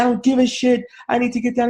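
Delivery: very high, insistent lady, imitated; acted indignant protest; mock stubborn outburst.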